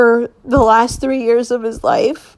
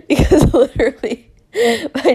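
real laughter breaking through the words; laughing; 5 semitones higher, faster than usual. Real, not acted. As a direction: laugh while getting the words out. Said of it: "And I keep laughing".

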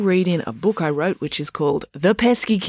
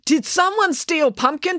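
much higher demanding cry; frantic girl demanding; 13 semitones higher than usual. A narrator's reading. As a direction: insist on an answer, pitched up. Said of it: "demanded April".

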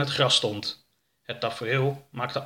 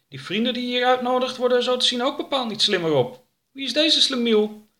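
high, fast, sneering; wife mocking her husband's friend, pitched up and quick; scornful wife; 11 semitones higher than usual. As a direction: look down on the stranger, quick and high. Wife sneers at alien guest.

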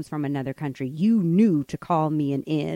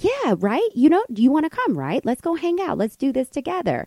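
high, insistent mock coaxing; pushy invitation; 8 semitones higher, 3 dB louder than usual. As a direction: imitate a stranger who won't take no. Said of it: "she just kept pushing".

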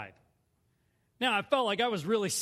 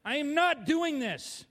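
slow, high angry refusal; mad; 7 semitones higher, 4 dB louder, slower than usual. Retold or imitated. Refusing the Lord's riskier way, mad. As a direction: space out the words in raised defiance. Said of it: "I was mad".

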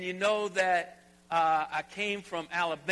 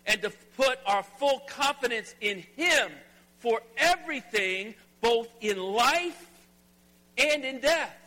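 full-force preaching; 4 semitones higher, 6 dB louder than usual. Acted. Pastor driving home the sermon's main point, full-force preaching. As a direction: build the main point to a ringing climax.

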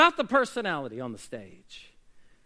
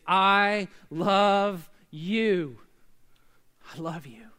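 tender slow I love you; much slower than usual. Acted. Church's motive voiced to people.

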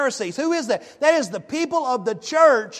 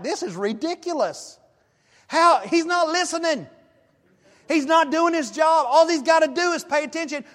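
higher, exasperated complaint about the waiter; preacher replaying his own irritation at the waiter, higher and exasperated; mock frustrated rant; 7 semitones higher than usual. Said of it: "I'm like".

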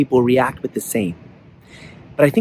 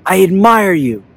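raised, drawn-out call; yelling; 5 semitones higher, 4 dB louder, slower than usual. A narrator's reading. Father shows his son the echo, yelling. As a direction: call it out high into the distance. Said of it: "The father yells".

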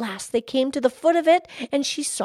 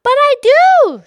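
high, loud, drawn-out cry; 13 semitones higher, 13 dB louder than usual. A narrator's reading. Girl interrupting the fish, crying out. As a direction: burst out at the top of the voice. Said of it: "she cried".

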